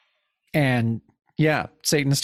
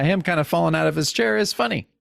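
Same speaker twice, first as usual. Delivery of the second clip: ordinary talk, nothing marked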